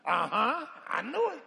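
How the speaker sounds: loud, high gotcha; mock church gossip. Church member catching preacher at the club, loud mock triumph. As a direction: catch him out, smug and loud.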